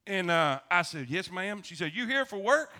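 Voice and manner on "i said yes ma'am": normal talking voice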